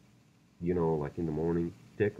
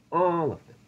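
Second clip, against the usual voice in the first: shouted, much higher and louder; angry outburst; 11 semitones higher, 7 dB louder than usual. Real, not acted. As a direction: blurt it out in a sudden burst of anger. Ranting about shutting studios down.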